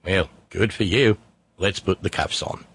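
unbothered cop, lightly Australian; deadpan it in a put-on Aussie accent; lightly voiced Australian officer; mock Australian police officer. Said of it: "police officer".

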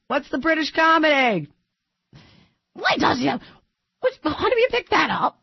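high, hammed-up British accent; Monty Python British voice. Host stuck in her British accent, asking for help. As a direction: play it like a Monty Python dame. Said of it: "The Life of Brian".

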